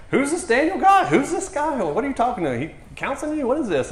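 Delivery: high, loud, incredulous questioning; hammed-up outraged grilling; mock indignant husband. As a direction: demand answers like a jealous husband, played for comedy. Suspicious husband confronting, retold.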